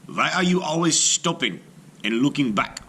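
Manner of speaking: father's question. A narrator's reading. Father asks his son why he stops, puzzled. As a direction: call back to the dawdling boy.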